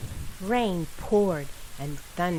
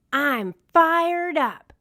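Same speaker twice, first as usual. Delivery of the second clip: fired up; 9 semitones higher, 6 dB louder, much slower than usual. Acted. Marshall eager for mission.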